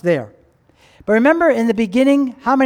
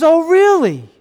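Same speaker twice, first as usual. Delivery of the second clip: high, louder sarcastic "really"; 6 semitones higher, 5 dB louder, slower than usual. Acted. Older son reacting to the servant's news, sarcastic. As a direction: throw it back with disbelief.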